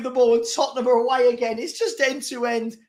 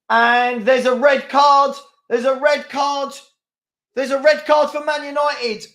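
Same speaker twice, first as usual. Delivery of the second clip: louder, slowed call of the red card; excited shout; 3 semitones higher, 5 dB louder than usual. Real, not acted. Fan seeing United's red card, excited shout. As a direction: announce the red card to the room.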